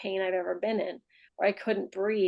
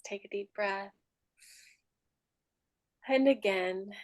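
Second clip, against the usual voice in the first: guided meditation; 8 dB quieter than usual. Real, not acted. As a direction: ease them down gently, with long pauses between phrases. Leads a final meditation.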